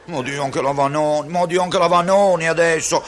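scandalised. Acted. Conscience shocked at Vanoni.

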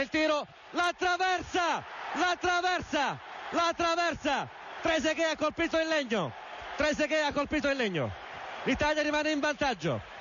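high shouted call, hit the bar; excited shout. Real, not acted. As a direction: yell it out in disbelief. French penalty hits the bar.

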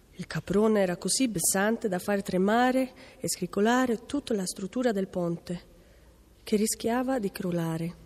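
plain reading voice

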